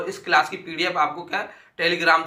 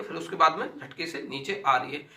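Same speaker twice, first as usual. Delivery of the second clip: ordinary talk, nothing marked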